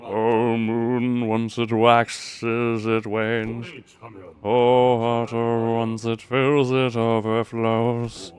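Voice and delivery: slow, solemn chanting of a verse; dramatic recitation. Acted. Streamer reading the game's ritual verse aloud, slow and solemn. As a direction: intone each line slowly like an incantation.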